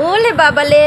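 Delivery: put-on cooing baby-talk "ওলে বাবালে" with r turned to l; doting mother's baby talk. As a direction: coo "ওলে বাবালে" like to a toddler. Cooing at the child.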